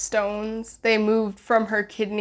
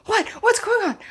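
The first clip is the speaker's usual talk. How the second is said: dad's alarmed question; 11 semitones higher than usual. Retold or imitated. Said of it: "and he's".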